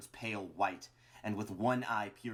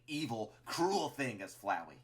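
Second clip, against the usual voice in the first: usual speaking voice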